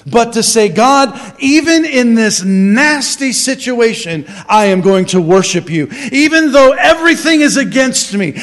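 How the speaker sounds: loud, emphatic, stretched key words; full-force preaching. Real, not acted. Preacher urging the church to worship regardless, full-force preaching. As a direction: preach it with rising conviction.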